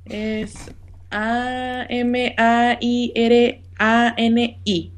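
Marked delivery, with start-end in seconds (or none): 1.1-4.9 A M A I R A N I, letra por letra, muy despacio; spelling it out. Shown as a demonstration. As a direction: deletrea A M A I R A N I lentamente. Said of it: "le puedes deletrear tu nombre a Roberto". (deletreando A M A I R A N I pausado)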